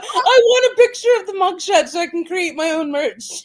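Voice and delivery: normal talking voice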